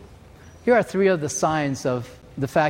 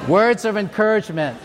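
laughing through the words; words bubbling with laughter; 6 semitones higher, 4 dB louder than usual. Real, not acted. Preacher laughing at his own joke, chuckling words. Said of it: "laughing at the punchline".

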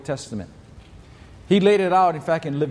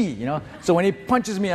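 ordinary talk, nothing marked

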